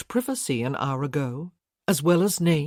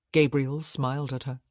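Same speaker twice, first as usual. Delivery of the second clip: ordinary delivery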